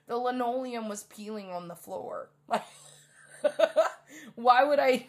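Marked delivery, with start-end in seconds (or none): 2.5-5.1 breaking into laughter; laughing. Real, not acted. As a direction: laugh bursting into "why would I". Laughing at the memory.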